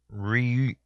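ordinary talk, nothing marked

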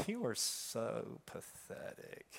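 soft, slow, whispered sneer; mock him in a cold whisper; enemy's sneering voice. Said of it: "I heard the voice of the enemy speak".

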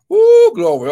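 high, loud ecstatic cry of glory; ecstatic woo. Real, not acted. Preacher overcome with praise, high loud cry. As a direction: burst out in praise.

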